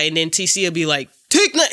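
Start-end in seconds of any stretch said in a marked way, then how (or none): 1.3-1.7 high, loud shouted name; hype shout. Retold or imitated. Retold TC shouting his own name. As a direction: yell it like a hype man.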